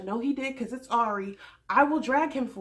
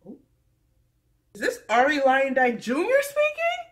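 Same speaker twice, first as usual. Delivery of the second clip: louder, higher mocking jab; sassy jab; 5 semitones higher, 9 dB louder than usual. Mocking Ari's cold reply, loud sassy jab. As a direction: call it out with sass. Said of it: "I was just like".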